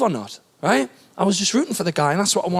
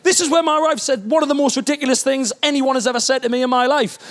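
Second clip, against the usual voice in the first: ordinary talk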